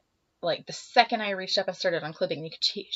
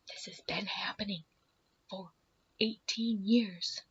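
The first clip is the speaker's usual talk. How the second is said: whispering; 5 dB quieter than usual. Retold or imitated. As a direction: confide it in a bare whisper. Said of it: "we're whispering, mind you, in the stall".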